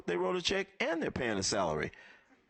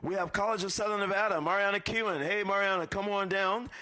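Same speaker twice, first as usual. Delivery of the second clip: game show call-up; 6 semitones higher than usual. Real, not acted. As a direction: summon her to the stage like a game show host. Emcee calling up sponsor.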